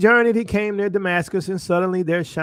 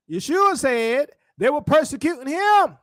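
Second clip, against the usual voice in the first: higher, more forceful preaching delivery; emphatic repeat; 6 semitones higher than usual. Real, not acted. Preacher repeats the point after "Come on now", emphatic. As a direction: drive it home to the congregation.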